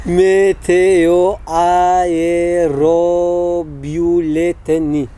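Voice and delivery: very slow, syllable-by-syllable متئوآیروبیولتنی; careful pronunciation. Shown as a demonstration. Host models a hard Russian word, careful and very slow. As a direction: stretch out every syllable of متئوآیروبیولتنی.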